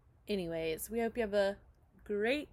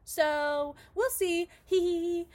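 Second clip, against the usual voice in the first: high, louder sing-song with mock giggle; playful scheming; 9 semitones higher, 5 dB louder than usual. Character plotting to take him down, playful scheming. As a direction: plot it playfully, pitched up high. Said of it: "that's what she says".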